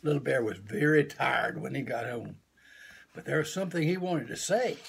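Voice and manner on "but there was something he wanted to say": plain reading voice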